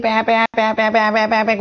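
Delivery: quick nasal-free honking, প্যাঁ প্যাঁ repeated fast; playful mock horn blasts for the class; imitating a car horn. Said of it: "অনেক গাড়ি সেই ... করতে থাকে". (গাড়ির হর্নের নকল)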